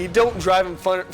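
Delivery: ordinary delivery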